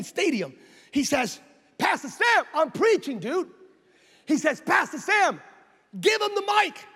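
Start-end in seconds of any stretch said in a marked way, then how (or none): 1.8-3.5 calling out. Retold or imitated. Retold interruption across a stadium. 4.6-6.8 high, raised-voice command; urgent calling out. Retold or imitated. Jerry calls to preacher over crowd.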